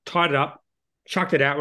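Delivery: ordinary talk, nothing marked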